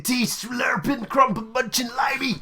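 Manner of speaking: high put-on dude jeer; mock bro insult. Co-host joining the joke, high mock bro voice.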